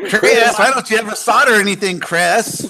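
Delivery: mock nagging. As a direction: nag him like a fed-up parent.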